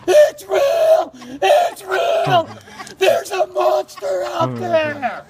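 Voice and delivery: high, loud, sobbing half-laughing cry; terrified crying shout. Retold or imitated. Mike runs up to Dad crying in terror. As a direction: scream it through tears in panic. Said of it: "Tears are falling out of his face".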